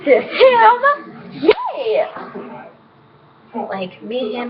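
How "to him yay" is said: 1 excited cheer. Real, not acted. Cheering about being together.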